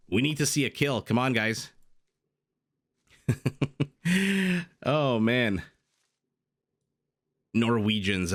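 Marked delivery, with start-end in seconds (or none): 3.3-4.8 high-pitched chuckle; amused commentator's laugh. Real, not acted. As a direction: burst into a real high laugh. Reacts to the game action.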